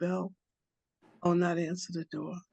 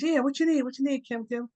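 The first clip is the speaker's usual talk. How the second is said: much higher, eager squirrel; 7 semitones higher, 3 dB louder than usual. Acted. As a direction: jump in high and keen. Squirrel eager to help Kim.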